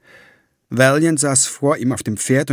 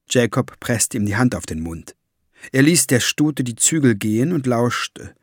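plain reading voice

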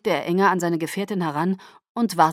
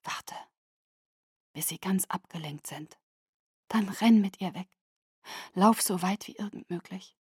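soft urgent whisper in the ear; 5 dB quieter than usual. A narrator's reading. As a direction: breathe the plan into his ear. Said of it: "flüsterte ihm ins Ohr".

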